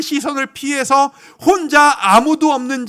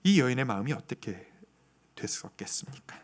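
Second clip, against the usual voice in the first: ordinary delivery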